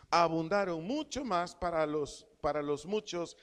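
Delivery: preacher proclaims the verse, leaning on each phrase; scripture reading. Acted. Preacher reads Romans to the church, emphatic scripture reading.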